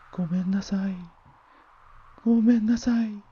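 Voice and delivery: put-on high tearful child's pleading; crying plea. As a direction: beg through tears, high. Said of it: "泣き続けていた".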